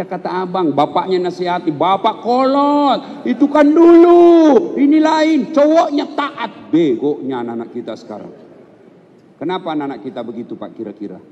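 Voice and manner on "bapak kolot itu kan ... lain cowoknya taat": higher, slow mock rebellious child; defiant teenager. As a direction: sneer back at the father like a spoiled kid. Imitating defiant child to father. (meniru anak membantah bapaknya)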